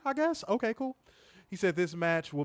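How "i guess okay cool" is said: sarcastic doubtful question. Real, not acted. Sarcastic doubt about teeth.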